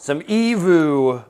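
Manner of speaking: loud, high, drawn-out cheer; playful exclamation. Pretend. Cook echoing the olive oil nickname, playful exclamation. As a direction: sing out "evu", stretching it long.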